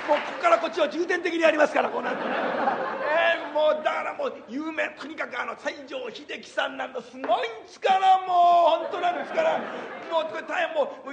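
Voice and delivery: breathless hyper comedian routine with laughs; Sanpei impression. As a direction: rattle on like the frantic comic, shouting bits. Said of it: "三平の舞台を一つここでちょっとやってみましょう".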